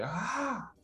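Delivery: sudden high shout ah; a short high tantrum yell; mock scream. Shown as a demonstration. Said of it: "soy así de gritar y de hacer un escándalo". (grito breve y agudo de berrinche)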